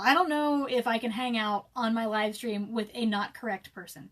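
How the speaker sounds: usual speaking voice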